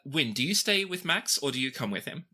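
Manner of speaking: ordinary talk, nothing marked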